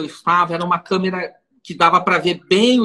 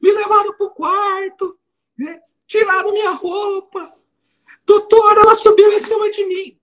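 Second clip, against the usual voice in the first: much higher, wailing sobbing plea, hammed up; mock weeping defendant; 12 semitones higher, 3 dB louder than usual. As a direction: imitate the defendant crying out to the judge. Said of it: "E chorando".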